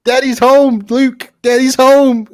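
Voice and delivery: high, loud teasing call; excited mock announcement. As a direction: announce daddy's home like a joke fanfare. Playful call after Vader bit.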